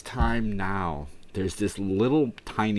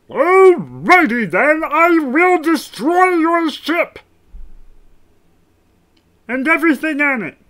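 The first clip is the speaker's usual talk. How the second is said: very high, loud villain threat; slow, shouted alien declaration; Marvin the Martian; 13 dB louder, slower than usual. Acted. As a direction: belt it out in a strained cartoon voice. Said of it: "Why can't I hit that tone?".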